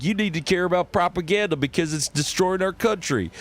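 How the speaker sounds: high, fervent preacher rant; character voice. Shown as a demonstration. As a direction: warn them like an alarmed pulpit preacher. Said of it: "some guys like".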